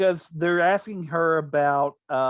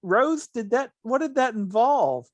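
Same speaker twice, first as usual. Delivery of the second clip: imitating Bea Arthur; 8 semitones higher, 3 dB louder than usual. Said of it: "She goes".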